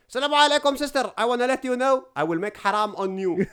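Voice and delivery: high, loud comic put-on accent; Mahmoud character voice. Creator reenacts his viral video line in the Mahmoud voice. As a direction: play the comedic persona, loud and high. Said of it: "all I said in the video was".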